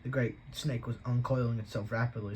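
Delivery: plain reading voice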